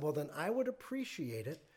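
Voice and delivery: soft, controlled, measured firmness; imitating a composed manager calming an angry customer. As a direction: set a limit quietly without heat. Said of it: "he said very calmly".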